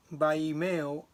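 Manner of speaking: ordinary delivery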